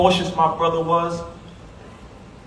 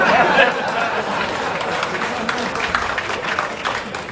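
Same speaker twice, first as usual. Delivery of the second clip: high, loud burst of laughter; real laugh remembering his brother; 9 semitones higher, 3 dB louder than usual. Real, not acted. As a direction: let the laugh roll out. Laughing at the memory.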